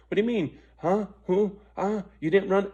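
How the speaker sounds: high, loud, baffled questions; confused surprise. Retold or imitated. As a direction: sound thrown by what you just heard. Driver realizes he was conned.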